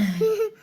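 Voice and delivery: quick high giggle; short giggle. Real, not acted. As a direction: giggle at the funny word. Giggle at funny word marshmallows.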